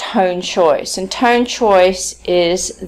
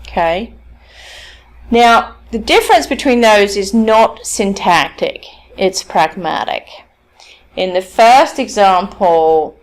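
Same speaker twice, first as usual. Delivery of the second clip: ordinary delivery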